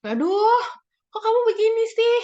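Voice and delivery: high, loud, exasperated yell; mock yelling. Punisher yelling at a misbehaving child, mock exasperated shout. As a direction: yell it like a fed-up punishing parent. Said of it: "yang dilakukan adalah yelling, teriak-teriak".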